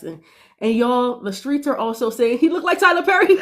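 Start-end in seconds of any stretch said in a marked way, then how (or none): 1.2-3.4 rising pitch into a real laugh; breaking into laughter. Real, not acted. Gossip passing on the streets' lookalike joke, breaking into laughter. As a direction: crack up as the joke lands.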